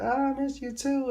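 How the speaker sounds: put-on squeaky, high girly voice; high mock girl. Girl says goodbye after his hug, high mock sweetness.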